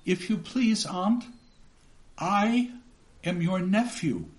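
put-on a small boy's hesitant plea; timid boy. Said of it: "I began".

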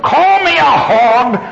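indignant high-pitched yell; furious yelling. A narrator's reading. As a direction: holler it across a field in a rage. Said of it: "she yelled".

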